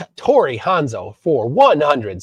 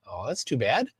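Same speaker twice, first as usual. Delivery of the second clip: normal talking voice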